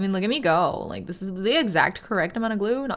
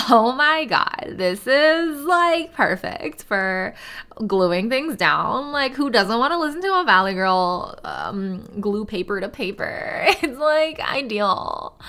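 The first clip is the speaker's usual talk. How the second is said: put-on slow, bubbly Valley Girl accent; 2 semitones higher, 4 dB louder, slower than usual. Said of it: "You want a Valley Girl accent".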